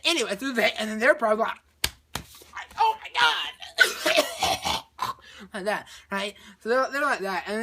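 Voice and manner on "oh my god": high, loud, drawn-out horrified cry; hammed-up shriek breaking into retching; mock horror. Astronauts find a crewmate's head blown in, mock horror. Finding dead crewmate, retold.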